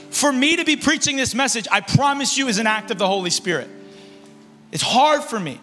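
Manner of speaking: ordinary talk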